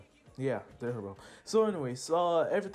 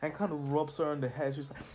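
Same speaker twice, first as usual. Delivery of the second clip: plain reading voice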